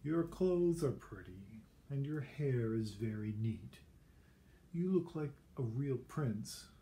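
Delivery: slow, sugary praise; butter him up before the blow; mock sweet. Elizabeth to Ronald, slow and falsely sweet.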